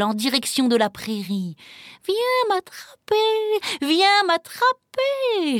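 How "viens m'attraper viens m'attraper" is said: high, drawn-out teasing chant; singsong little bird. A narrator's reading. Little bird teasing Pierre to catch it, singing. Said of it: "chantait le petit oiseau".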